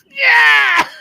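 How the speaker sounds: real high shout breaking into loud laughter; real hysterical yell of laughter; shrieking laugh. Real, not acted. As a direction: whoop and howl with laughter. Bursts out laughing at punchline.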